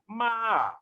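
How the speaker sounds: "ma" from high pulled down; falling tone. Shown as a demonstration. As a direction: drop "ma" from the top. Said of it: "pulling the muscles down".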